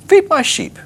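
very high-pitched, comic non sequitur; weird Jesus. Acted. Jesus answering Peter's love, weirdly high voice. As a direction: pop it out in a silly high voice. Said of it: "It's weird".

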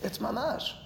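high-pitched quoted insistence; quoted counterclaim. Acted. Teacher quoting Harambam's surprising claim, high-pitched insistence. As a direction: lift the quote well above normal pitch. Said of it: "Harambam in his Moreh Nevuchim says".

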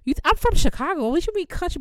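high, loud, affronted comeback; indignant retort. Retold or imitated. Retelling comeback to sorority sister, indignant. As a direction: push back in mock outrage, pitch jumping up.

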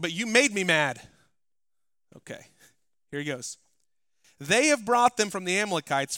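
impassioned pulpit delivery; full-force preaching. Acted.

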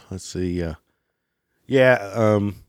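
ordinary talk

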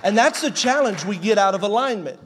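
loud, rising sermon cry; preacher at full volume, driving the point home; full-force preaching. Acted. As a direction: preach it out to the whole room.

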